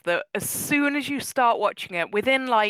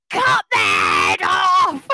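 high, loud, slow shout; shouting at the screen; 10 semitones higher, 8 dB louder, much slower than usual. Retold or imitated. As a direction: yell the order at the screen. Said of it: "And I'm always screaming at".

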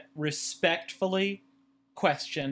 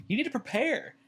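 usual speaking voice

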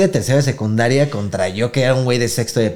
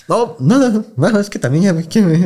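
put-on scared kid voice; 9 semitones higher than usual. Child voice pleading to bully.

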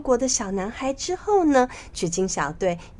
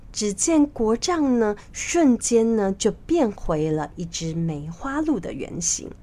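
ordinary delivery, nothing marked

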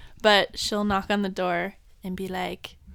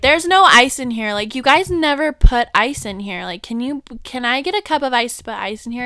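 louder complaining mom at the counter; mock yell; 3 semitones higher, 8 dB louder than usual. Mom complaining loudly to the drive-thru worker, mock yell. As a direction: imitate mom yelling at the worker. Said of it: "she will yell over me".

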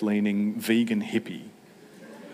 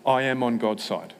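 ordinary talk

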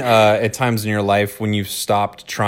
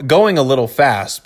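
ordinary talk, nothing marked

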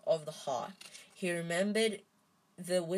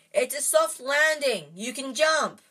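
loud higher call upward; 8 semitones higher, 9 dB louder than usual. A narrator's reading. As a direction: reassure them from far below, loud. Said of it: "he called up to the light".

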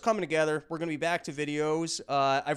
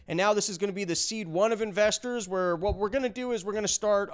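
normal talking voice

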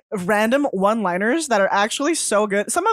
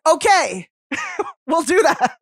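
casual agreement; 6 semitones higher than usual. Retold or imitated. Everybody's just like, casual quote.

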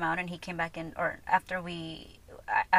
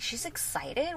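ordinary talk

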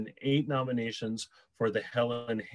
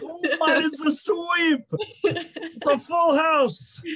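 high, loud laughing exclaim; laughing shout; 9 dB louder than usual. Retold or imitated. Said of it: "I was like".

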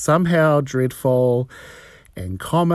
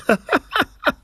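high-pitched real giggle; amused laugh at own joke. Real, not acted.

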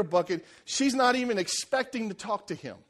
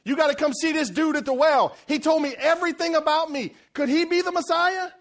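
much higher and louder, bursting with news; excited telling; 9 semitones higher, 6 dB louder than usual. Retold or imitated. Woman telling the villagers about Jesus, thrilled and loud. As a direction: run to town shouting the news. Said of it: "That was her message".

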